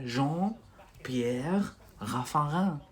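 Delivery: Jean-Pierre Raffarin said very slowly with a French r; slow French name. Shown as a demonstration. Teacher slowly modelling a French name. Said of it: "This is French".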